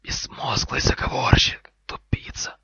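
loud, slow insulting whisper; angry snake hiss. Acted. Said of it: "Змея шипела так сердито".

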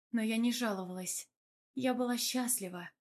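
usual speaking voice

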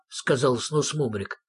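ordinary delivery

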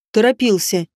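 ordinary delivery

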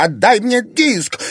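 high, loud, drawn-out demand; furious yell. A narrator's reading. Anfisa demanding the disc from Irina, furious yell. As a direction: yell it high, stretching the words. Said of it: "заорала Анфиса".

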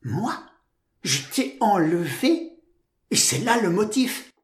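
wide-eyed, wounded astonishment; innocent surprise. A narrator's reading. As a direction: ask it in naive disbelief. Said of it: "s'exclama Georgette avec ses yeux de gazelle surprise".

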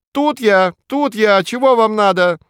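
громко, высоко, издалека откликается; кричит зайцем во весь голос; крик кролика. A narrator's reading. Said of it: "уши навострил и кричит". (кролик кричит издалека)

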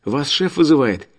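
put-on high chirpy girl voice; sing-song young receptionist; cartoon princess. A narrator's reading. Alina voiced on phone.

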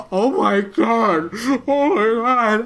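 high, gasping, slowed shock; horrified friend. Pretend. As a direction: gasp and plead as a stunned friend. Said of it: "I'll be that friend that's like".

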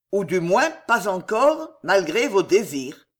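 ordinary delivery

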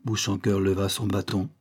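ordinary delivery, nothing marked

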